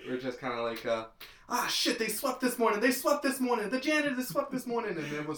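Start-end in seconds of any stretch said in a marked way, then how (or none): 1.5-5.0 high, fretful put-on crook voice; mock panicked crook. Podcaster mocks heist crooks, high and fretful. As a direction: whine it like a rattled thief. Mocking heist planning line.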